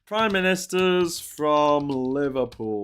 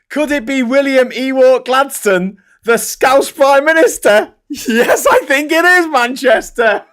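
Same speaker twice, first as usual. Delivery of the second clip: much higher and louder, crowing at the rivals; gleeful taunting; 12 semitones higher, 11 dB louder than usual. Real, not acted. Host crows to chat about Manchester's Scouse statue, gleeful and loud. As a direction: rub it in, triumphant and gleeful.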